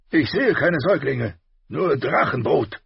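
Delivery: loud, high, blustering king voice; gruff king. A narrator's reading. Robert voiced in audiobook.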